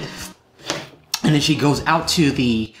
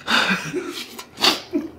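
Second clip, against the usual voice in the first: breathless pained laugh; 8 semitones higher, 5 dB quieter than usual. Real, not acted. Reacting to spicy heat.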